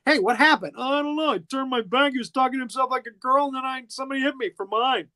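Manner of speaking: hammed-up bewildered explanation; flustered guard. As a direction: whine it like a hapless guard covering himself. Dazed guard explains himself, retold.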